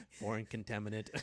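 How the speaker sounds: robot voice. Pretend. Robot flagging contaminant.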